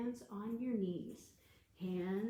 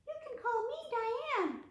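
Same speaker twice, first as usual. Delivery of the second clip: much higher cartoon cockroach voice; puppet voice; 12 semitones higher, 3 dB louder, much faster than usual. Cockroach puppet introduces itself to the children, squeaky high.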